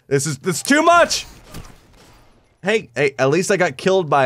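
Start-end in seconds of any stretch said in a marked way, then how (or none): 0.1-1.3 exasperated. Real, not acted. Overwhelmed by losing.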